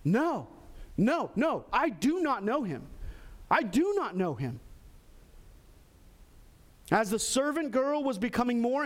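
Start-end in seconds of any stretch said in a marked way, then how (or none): 0.0-4.6 repeated insistent denial, pitch swooping on each word; emphatic denial. Acted. Peter denies knowing Jesus to his accusers, emphatic denial. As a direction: deny it firmly, stressing DO. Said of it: "indicating a repeated and more emphatic denial".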